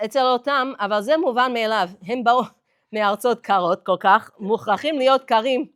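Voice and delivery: loud and fast, preaching the rabbi's words; loud quote. Retold or imitated. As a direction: hammer it out like a rabbi on a pulpit. Quoting the rabbi in Hebrew.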